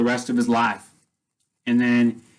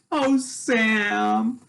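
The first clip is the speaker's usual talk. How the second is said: much higher, dreamy voice calling to a vision; longing sigh of a name; 9 semitones higher than usual. Retold or imitated. She touches the vision of Sam.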